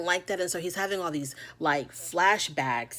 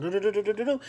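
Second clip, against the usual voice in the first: happy humming; 3 semitones higher, faster than usual. Retold or imitated. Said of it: "she was just like".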